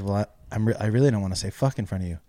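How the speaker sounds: low, hushed, halting then hurried; nervous confession. Retold or imitated. Grandchild reluctant to swear before grandmother, nervous and quiet. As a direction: imitate a reluctant admission under the breath. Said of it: "I was really nervous".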